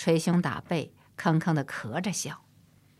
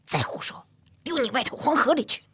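hissed start, then a sharp high threat; teeth-gritted threat; 7 semitones higher than usual. A narrator's reading. Mother scolds her boy on the boat, through gritted teeth. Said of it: "咬牙说道".

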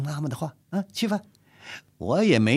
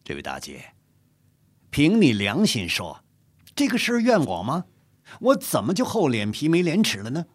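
frowning protest; 6 semitones higher, 5 dB louder than usual. A narrator's reading. The general protests to the mother, growing indignant. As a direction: push back, offended but restrained. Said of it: "皱着眉头说".

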